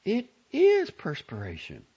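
high, loud mother aghast; scandalized motherly discovery; wonder and censure. A narrator's reading. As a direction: pronounce it high, amazed and disapproving. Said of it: "Her tone couldn't have held more wonder and censure".

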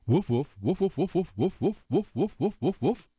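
high playful woofs; dog barking. Acted. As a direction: bark it like a dog. Dog dictates a telegram.

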